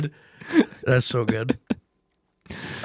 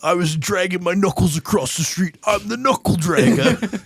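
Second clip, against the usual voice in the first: mock action hero; 4 semitones higher than usual. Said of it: "So he's like".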